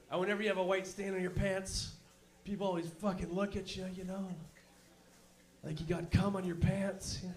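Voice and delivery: ordinary delivery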